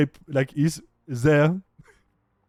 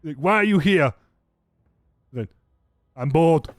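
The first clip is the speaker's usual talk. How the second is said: raised-voice bickering; petty back-and-forth at raised volume; acted squabble; 2 semitones higher, 4 dB louder than usual. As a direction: trade loud, short retorts.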